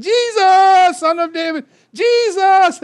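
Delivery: high, loud pleading cry; a blind beggar yelling over the crowd, high and loud; desperate shout. Retold or imitated. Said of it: "Can you imagine him saying".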